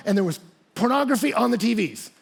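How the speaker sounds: usual speaking voice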